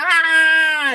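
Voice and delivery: high, sudden pained scream; child's scream. Retold or imitated. Boy screaming as his arm is ripped off, high shriek. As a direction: shriek like a kid in agony. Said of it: "he's just like".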